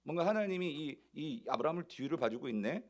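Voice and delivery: normal talking voice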